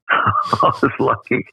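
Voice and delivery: amused, laughter in the voice; laughing. Real, not acted. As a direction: chuckle through it at your own luck. Laughs at his own luck.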